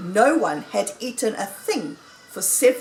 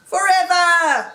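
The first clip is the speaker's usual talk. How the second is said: high, loud crowd cry of amazement; cried out; 9 semitones higher, 8 dB louder than usual. A narrator's reading. Animals echoing Mister Fox's invitation, crying out. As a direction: call it out long and high. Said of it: "they cried".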